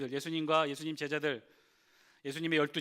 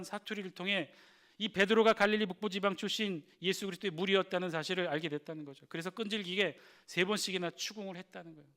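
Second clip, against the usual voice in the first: usual speaking voice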